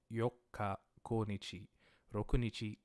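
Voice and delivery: ordinary delivery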